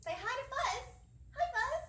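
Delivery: excited greeting. Acted. Greeting a puppet with kids.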